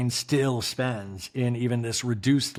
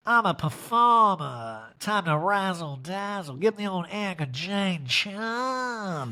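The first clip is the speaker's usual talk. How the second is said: hammed-up showbiz self-pep-talk, slow and higher; Erica; 5 semitones higher, slower than usual. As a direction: draw it out like a mock stage diva. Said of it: "So Erica does".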